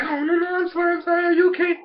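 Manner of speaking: put-on high-pitched anxious little girl; mock fussing apology. Ikki apologising to a startled squirrel, high fussing voice. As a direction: pitch it way up, pleading lightly.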